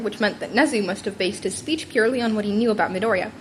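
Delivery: ordinary delivery, nothing marked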